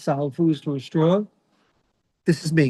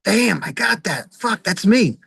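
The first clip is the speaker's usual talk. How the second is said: higher, slow dawning horror; mock realization; 5 semitones higher, 3 dB louder, slower than usual. Reading up on bipolar, he finds it fits: a slow, higher dismayed gasp. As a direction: act out recognizing yourself in the symptoms. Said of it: "And I'm like".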